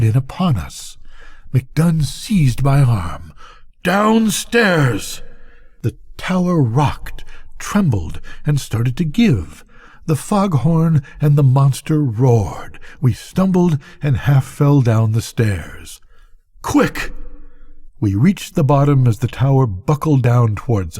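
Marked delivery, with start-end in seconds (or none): 3.8-5.2 high, sharp one-word order; old keeper yelling to flee the falling tower; urgent shout. A narrator's reading. 16.6-17.1 high, sharp hurry call; urgent cry. A narrator's reading. Hurrying down falling stairs.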